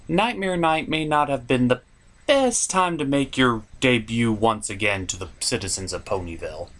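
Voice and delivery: usual speaking voice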